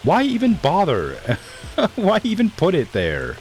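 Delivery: high-pitched incredulous protest; amused exasperation. Real, not acted. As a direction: protest in a high voice, breaking into laughter. Laughing exasperation at useless camera screen.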